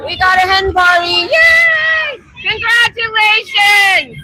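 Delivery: high, whooping congratulations; real delight, sung out high and drawn out; excited cheer. Real, not acted. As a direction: cheer a passing party, voice soaring. Cheering a passing hen party.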